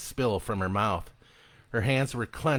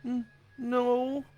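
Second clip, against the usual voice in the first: put-on high, hesitant child's no; a shaken boy answering; tearful stammer. A narrator's reading. Shaken boy answers his dad, stammering and high.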